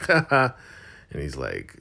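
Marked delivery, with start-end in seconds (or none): 0.1-0.5 high, loud put-on "ha ha"; mocking laugh. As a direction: imitate a derisive laugh. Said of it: "Malcolm just laughs like".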